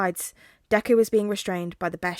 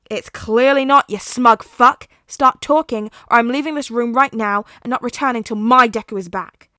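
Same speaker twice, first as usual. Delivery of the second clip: loud, fast angry shout; furious yelling; 3 semitones higher, 13 dB louder than usual. Acted. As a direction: scream it in his face. Katsuki yelling threat.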